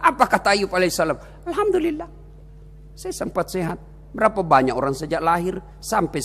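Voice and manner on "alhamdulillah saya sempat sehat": hushed, contented reply; soft-spoken serenity; calm, grateful. Acted. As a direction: answer gently, without complaint. Ayub calmly answers the devil's taunts.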